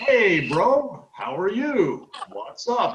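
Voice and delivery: over-enunciated, loud teacher greeting; teacher's work voice. Retold or imitated. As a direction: greet him like a beginners' English class. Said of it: "I was using my work voice".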